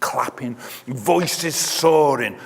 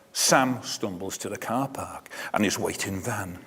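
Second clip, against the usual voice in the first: ordinary delivery, nothing marked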